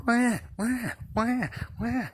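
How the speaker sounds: high wailing baby cry, imitated. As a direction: mimic a newborn wailing at night. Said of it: "you hear".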